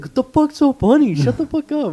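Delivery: very high-pitched mock outrage; retold indignation. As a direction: imitate a laughed-at classmate protesting. Retold anger at being laughed at.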